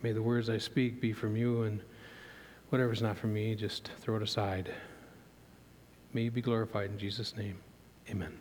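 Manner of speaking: soft, hushed prayer voice; a quiet opening prayer before the sermon. Acted. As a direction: pray it low and humble.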